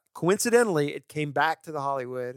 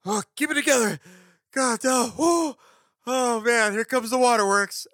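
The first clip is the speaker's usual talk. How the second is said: high, strained mock sob-fighting voice; hammed-up fighting back tears; mock choking up; 9 semitones higher, 3 dB louder than usual. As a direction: play holding back tears in a cinema, pitched way up. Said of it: "I'm sitting there going".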